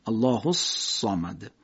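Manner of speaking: "الله الصمد" slowly, صاد hissed and heavy; slow whistled sad. Shown as a demonstration. Teacher slowly demonstrating the emphatic whistling صاد. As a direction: draw out "الصمد" with a clear hiss on ص.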